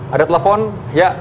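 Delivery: normal talking voice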